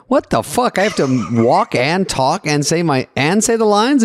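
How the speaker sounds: incredulous mock protest, pitched high; retold disbelief. Actor balking at having to walk and talk, incredulous. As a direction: play up baffled indignation, stretching the complaint. Acting out disbelief at director's instructions.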